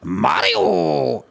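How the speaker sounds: hammed-up Scottish Mario catchphrase; Scottish accent. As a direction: announce yourself in a broad Scottish accent, drawn out. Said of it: "I'd do a fucking Scottish accent".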